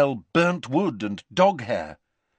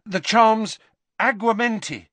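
higher, louder, quick instruction; eager boy's voice; 12 semitones higher, 5 dB louder than usual. A narrator's reading. Harry telling Hagrid the water charm, urgent. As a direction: tell him the spell.

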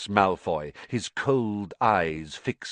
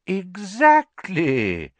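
slow, drawn-out pleased exclamation; glowing with approval; delighted old headmaster praising the boy; beaming; 12 semitones higher, 6 dB louder, much slower than usual. A narrator's reading. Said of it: "beaming once more".

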